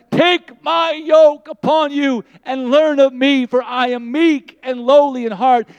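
loud, high, slow proclamation; preaching at full force. Acted. Preacher calling the congregation in God's voice, booming. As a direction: call it out over the congregation.

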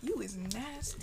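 soft, slow mock reproach; retold teacher's remark. Student imitating the teacher reacting to nasty talk, soft and slow. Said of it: "he would just be like".

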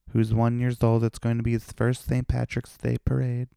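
high, sing-song camp take on mom's text. Retold or imitated.

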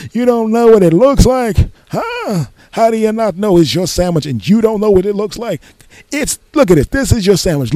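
high, rapid-fire exasperated rant; angry counter guy. Retold or imitated. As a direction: berate the customer in a high mocking voice. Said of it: "Being angry at me".

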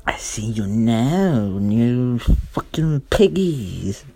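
slow, loud, creepy drawn-out threat; Donna two point oh. Acted. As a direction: stretch every word at the new neighbors menacingly. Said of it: "Donna two point oh goes".

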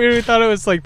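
normal talking voice

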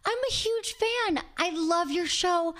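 high, drawn-out fangirl gushing; slow, high-pitched mock squeal of adoration; freaking-out fan. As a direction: imitate a starstruck fan gushing. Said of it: "she starts freaking out".

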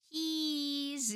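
high, long drawn-out kiddie cheese; kids saying cheese. Retold or imitated. Imitating little kids posing for photos, drawn-out singsong cheese. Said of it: "They all say it the same way".

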